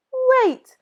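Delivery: very high quick little mouse; a clever mouse stalling. Acted. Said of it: "said the mouse".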